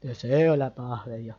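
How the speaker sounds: "Deseo la paz de Dios" dropped low; low-pitched. Shown as a demonstration. Teacher showing the phrase said in a low tone. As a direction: say it down in a deep voice. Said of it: "con diferentes tonos agudos y graves".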